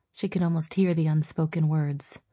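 plain reading voice

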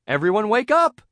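high, loud teacher's wake-up call. A narrator's reading. Teacher to sleeping students, calling out. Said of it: "Missus Puff called".

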